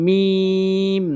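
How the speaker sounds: মিম with the m held long; মিম pronounced slowly with long nasal hum; drawn-out meem. Shown as a demonstration. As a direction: stretch মিম slowly, lips closed. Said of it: "দুই ঠোঁটের শুকনো অংশ মিলিয়ে".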